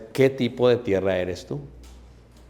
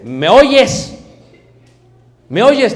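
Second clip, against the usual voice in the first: nagging wife; 9 semitones higher, 10 dB louder, much slower than usual. Pretend. Wife voiced in marriage scene.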